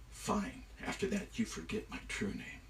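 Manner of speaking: very soft, hushed concession; grudging agreement. Acted. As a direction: give in quietly, setting one condition. Loki reluctantly agrees.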